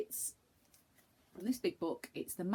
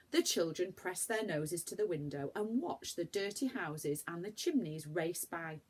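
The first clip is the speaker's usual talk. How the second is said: plain reading voice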